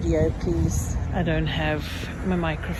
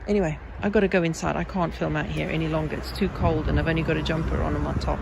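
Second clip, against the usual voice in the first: normal talking voice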